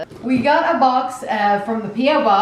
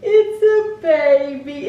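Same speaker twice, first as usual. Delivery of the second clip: high, slow squeal of delight; overwhelmed by a cute gift; much slower than usual. Real, not acted. Toy baby unboxed, squealing.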